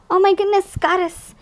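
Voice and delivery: real excited oh my goodness; excited exclamation. Real, not acted. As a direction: gasp it out, thrilled by the card. Excited at card pull.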